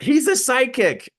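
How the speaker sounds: high mock yell from the crowd; retold yell. Audience member calls out to the speaker, mock yell. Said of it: "somebody yells out".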